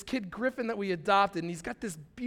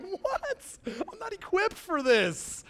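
baffled; 9 semitones higher, slower than usual. Retold or imitated. Baffled at crying wife.

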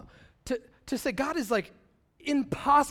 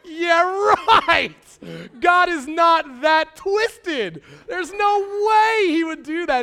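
laughing; 8 semitones higher, 11 dB louder, slower than usual. Retold or imitated. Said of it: "I just start cracking up".

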